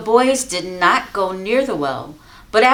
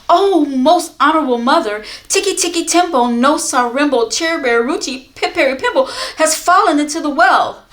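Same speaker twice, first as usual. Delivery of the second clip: urgent report; 6 semitones higher, 5 dB louder than usual. Acted. Chang reports brother fell.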